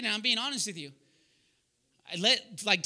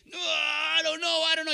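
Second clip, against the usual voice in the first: stretched, hammed-up protest; mock wail; 8 semitones higher, 5 dB louder than usual. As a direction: wail a long exaggerated no. Acted proud refusal to admit it.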